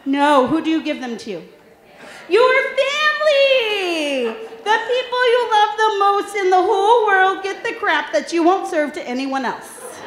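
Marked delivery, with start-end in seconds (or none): 2.3-6.6 drawn-out, much higher, loud; exclaimed punchline. Real, not acted. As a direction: cry it out slowly to the crowd. Punchline to the audience.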